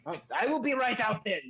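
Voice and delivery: high-pitched huffy character reply; armorer calling back. Acted. Armorer answers partner from back room, put-upon call. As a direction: call out that you are on your way.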